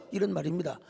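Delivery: normal talking voice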